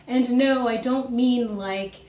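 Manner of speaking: ordinary talk, nothing marked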